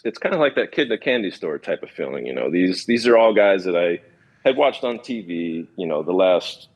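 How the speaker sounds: ordinary talk, nothing marked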